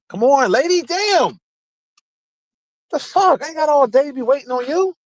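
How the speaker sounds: high, mocking impatient driver yelling. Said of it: "everybody and their mama just be".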